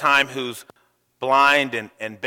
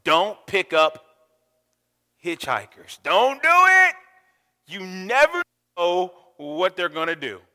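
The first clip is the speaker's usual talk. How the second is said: mother's warning; 4 semitones higher than usual. Retold or imitated. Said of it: "My mother always told me".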